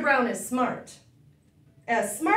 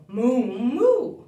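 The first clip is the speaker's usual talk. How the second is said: "moo" stretched and mooed like a cow; 6 dB louder than usual. Pretend. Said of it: "He can go like a cow".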